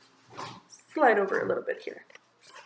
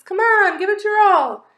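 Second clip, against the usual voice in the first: high, loud squeaky cheer; rally him like a tiny coach; a mouse urging the bunny over a wall; 9 semitones higher, 8 dB louder than usual. Acted.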